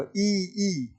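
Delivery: long, soft ई, held twice; demonstrated vowel. Shown as a demonstration. Teacher telling students to watch the tongue, demonstrating ई. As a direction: sustain each ई as "ee". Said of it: "देखो".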